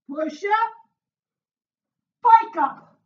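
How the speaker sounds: loud, high exercise call; called-out cue. Real, not acted. Trainer calling the move to the class, loud call.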